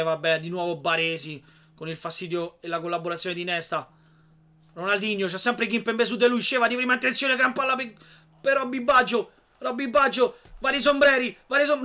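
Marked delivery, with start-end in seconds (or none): 4.7-11.8 rising, louder play-by-play call; building commentary. Real, not acted. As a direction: build up the attack breathlessly.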